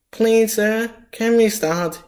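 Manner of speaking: high, loud pupil's plea; eager pupil. Acted. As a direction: call out to teacher like a schoolchild. Plays pupil asking the teacher.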